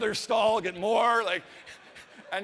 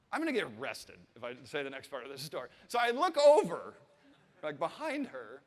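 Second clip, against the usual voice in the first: normal talking voice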